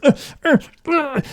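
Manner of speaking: high-pitched straining grunts, imitated; strained grunts. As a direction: imitate bursts of wordless strain. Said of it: "You can hear me just".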